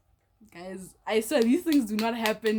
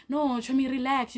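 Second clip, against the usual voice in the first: raised, louder placating appeal; placating friend; 5 semitones higher, 4 dB louder than usual. Retold or imitated. The friend smoothing over the quarrel, placating in a higher voice. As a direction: imitate a friend urging her to relax.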